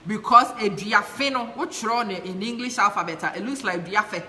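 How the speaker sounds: normal talking voice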